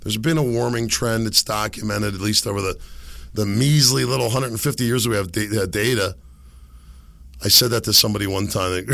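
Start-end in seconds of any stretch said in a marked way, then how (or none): none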